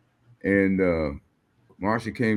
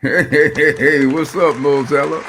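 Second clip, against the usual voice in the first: loud greeting; 5 semitones higher, 9 dB louder, slower than usual. Real, not acted. Guest's loud greeting on stage.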